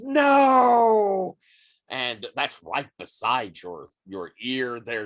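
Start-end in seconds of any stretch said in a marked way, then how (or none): 0.0-1.4 long, high, loud cry of "no"; big drawn-out dramatic scream. Acted. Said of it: "Joshua screams".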